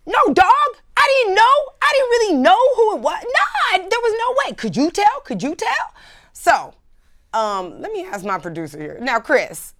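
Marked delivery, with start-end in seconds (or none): 0.1-5.9 much higher, loud mock pleading of the embarrassed guy; defensive guy. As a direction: protest your innocence in a high squeaky voice. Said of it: "And he's like".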